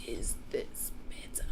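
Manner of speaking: barely audible whisper; very soft, breath-only question; under the breath. A narrator's reading. Sophie testing her volume for the BFG, whisper. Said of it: "speaking right under her breath".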